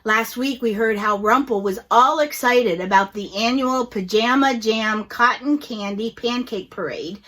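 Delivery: ordinary delivery, nothing marked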